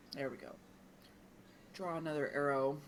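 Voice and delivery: usual speaking voice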